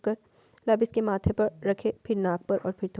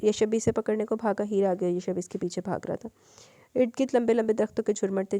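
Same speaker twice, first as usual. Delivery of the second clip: ordinary delivery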